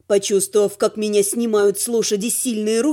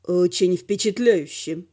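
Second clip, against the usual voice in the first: low, slow male voice; unimpressed onlooker, slow and low; sardonic dragon; 7 semitones lower, slower than usual. A narrator's reading. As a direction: drop low for dry mockery. Velor mocks from behind.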